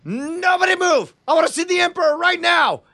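high, forceful hostage-taker's yell; commanding shout. Acted. Player threatens a crowd with chainsaw, commanding shout. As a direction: shout orders to the whole room. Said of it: "and I say".

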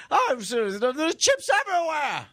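high-pitched, hammed-up British shouting; crazed British caricature way up high; excited mock British. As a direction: panic in a silly high British accent. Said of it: "sound so excited and crazy".

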